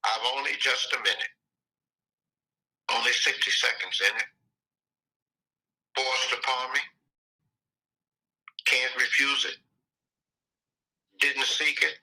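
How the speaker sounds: slow recitation, long pause after each line. Shown as a demonstration. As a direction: let every short line stand alone. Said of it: "So let me slow down".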